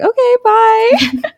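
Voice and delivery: very high, stretched-out mock-cheery goodbye; retold sheepish high-pitched farewell; awkward sign-off. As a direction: squeak out an embarrassed goodbye, slowly. Retold awkward sign-off after blunder.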